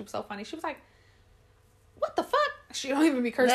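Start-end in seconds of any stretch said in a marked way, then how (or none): 2.0-2.5 very high mock shock; shocked stepmom. Stepmom opening the onesie, high imitated shock. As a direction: squeal it in mock disbelief.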